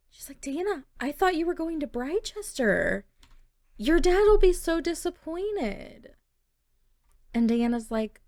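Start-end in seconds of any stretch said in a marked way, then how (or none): 0.4-6.1 dismayed. Acted. Rachel dismayed at choice.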